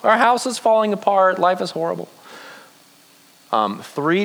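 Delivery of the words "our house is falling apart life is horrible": high-pitched mock wail, easing down; mock rooftop cry. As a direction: lightly cry it out as if to the whole town. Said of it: "like, screaming from the rooftops".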